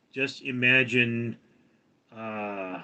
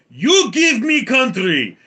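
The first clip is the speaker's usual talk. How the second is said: put-on loud, high, slow demand in a Russian accent; Putin; 10 semitones higher, 9 dB louder, slower than usual. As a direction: bellow it as a Russian tyrant. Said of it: "Putin comes up to Ukraine and goes".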